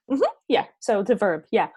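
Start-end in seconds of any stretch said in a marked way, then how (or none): none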